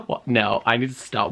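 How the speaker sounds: ordinary talk, nothing marked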